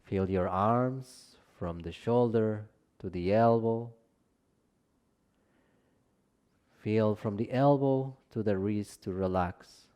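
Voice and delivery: calm Yoga Nidra guiding voice with long pauses; guided meditation. Real, not acted. Teacher leading listeners into Yoga Nidra rest, calm guided meditation. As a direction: ease them into rest, gently, leaving space between phrases.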